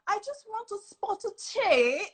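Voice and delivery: high, playful put-on British accent; fake British accent. Host showing how she walks around, playful British accent. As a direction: pipe up in mock British for fun. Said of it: "I would walk around and I'll be like".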